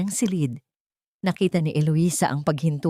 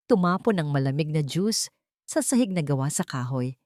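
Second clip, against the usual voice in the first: usual speaking voice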